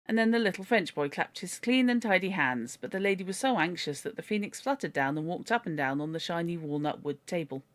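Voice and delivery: plain reading voice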